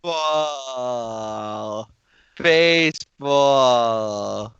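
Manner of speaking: very slow, stretched word; teasing a friend who seems glum; drawn-out mock moan. Mocking reply to Devin.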